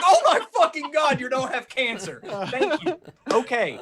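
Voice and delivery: high laughing relief; relieved laughing. Retold or imitated. Relief after the bad-news lunch.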